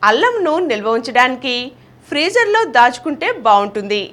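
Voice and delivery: usual speaking voice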